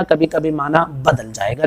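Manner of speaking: usual speaking voice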